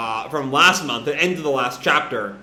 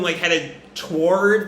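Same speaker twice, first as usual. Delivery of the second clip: ordinary delivery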